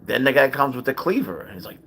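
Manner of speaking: ordinary talk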